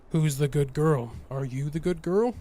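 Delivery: mock a man cooing at his dog; dog baby talk. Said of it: "walking up to your dog and be like".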